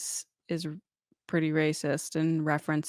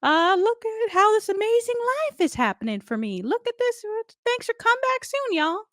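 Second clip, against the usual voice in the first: very high, overdone sweet farewell; sing-song it like a theme-park host; hammed-up Tiana thanking riders; Tiana impression. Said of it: "she's like".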